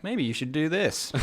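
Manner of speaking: mock-helpful faculty voice; mock tactful suggestion. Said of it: "they were like".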